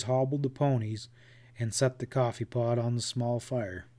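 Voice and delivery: ordinary delivery, nothing marked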